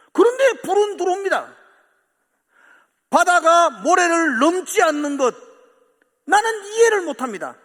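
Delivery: loud, high, impassioned preaching; full-force preaching. Acted. Pastor pressing the congregation, full-force preaching. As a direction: preach to the climax, voice near tears.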